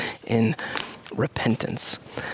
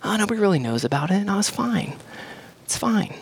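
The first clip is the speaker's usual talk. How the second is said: breezy, higher, dismissive excuses; a sinner shrugging off his sin; mock downplaying; 6 semitones higher, faster than usual. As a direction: brush it off like it's nothing. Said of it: "We downplay it, like".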